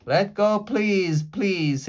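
high, loud begging; pleading shout. Acted. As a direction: beg the octopus at full voice. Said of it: "Annie shouted at the octopus".

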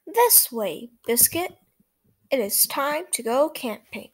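lively read-aloud for small listeners; reading to children. A narrator's reading. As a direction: read it brightly to little kids. Reads picture book to children.

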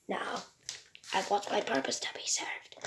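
whispered robot demand. Acted. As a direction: hiss it out as the robot.

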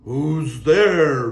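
loud booming whale. Acted. Whale answering the knock, booming loud. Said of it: "boomed the whale".

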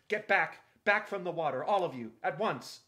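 loud, sharp, high command; sharp shout. A narrator's reading. Edmund ordering everyone back from the water, sharp shout. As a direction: bark the order so nobody disobeys. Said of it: "shouted out in the sharp voice".

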